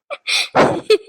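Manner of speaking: short wordless laugh. Acted. Mother laughs in the middle of her daughter's scolding, laughing. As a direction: let a little laugh slip out. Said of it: "எதுக்கு சிரிக்கா".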